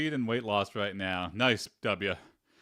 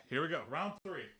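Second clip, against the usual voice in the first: ordinary talk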